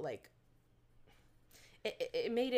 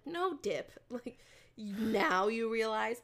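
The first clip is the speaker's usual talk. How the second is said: higher, louder mock-incredulous comeback; sarcastic retort; 4 semitones higher, 5 dB louder, slower than usual. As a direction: throw it back at him, incredulous. Mock retort to Nixon's statement.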